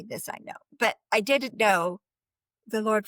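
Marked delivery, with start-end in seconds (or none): 0.0-0.5 a soft unvoiced private whisper. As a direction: whisper the line under the breath. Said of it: "I knew that Jesus loves me".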